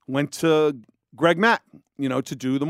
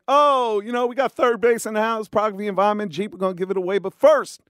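pitched-up DJ hype; radio DJ voice; 5 semitones higher than usual. Retold or imitated. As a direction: announce it like a morning show DJ. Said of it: "Cracks the mic".

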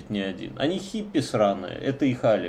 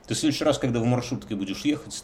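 normal talking voice

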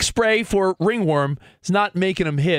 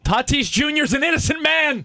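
insistent declaration; 8 semitones higher than usual. Real, not acted. Defending player in argument.

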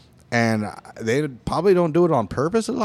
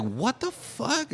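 slow, disbelieving what the fuck; 11 semitones higher, 6 dB quieter, much slower than usual. Retold or imitated. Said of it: "it's like".

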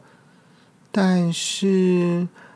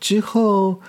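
ordinary delivery

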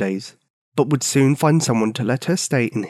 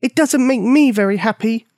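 higher, a bit louder mock yell; yelling girl; 10 semitones higher, 4 dB louder than usual. A narrator's reading. Bonnie yelling back at the old man, mild yell. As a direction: cry it out at him, stung. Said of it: "yelled Bonnie".

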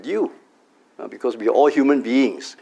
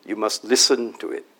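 usual speaking voice

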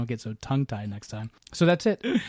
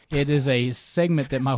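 normal talking voice

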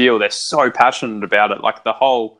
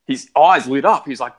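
ordinary talk

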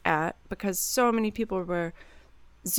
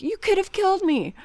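high-pitched, dramatic accusation; mock-horrified outrage; acted catastrophizing; 10 semitones higher than usual. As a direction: overreact as if you nearly died. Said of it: "there's also the catastrophizing".

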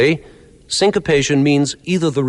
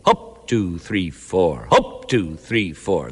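sergeant's count; 6 semitones lower, 3 dB quieter, much slower than usual. Shown as a demonstration. Teacher mimics a sergeant counting a march. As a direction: drill sergeant count, first beat punched. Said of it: "the sergeant still counts out in four".

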